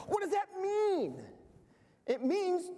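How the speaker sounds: high, mock puzzled question; rhetorical question. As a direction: ask as if confused.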